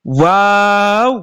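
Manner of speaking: ওয়াও stretched slowly with a rise; drawn-out Arabic waw. Shown as a demonstration. Teacher models the hard letter, long rising ওয়াও. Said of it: "এই হরফটার উচ্চারণ হবে".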